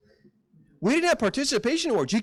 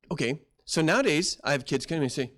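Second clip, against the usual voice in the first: ordinary talk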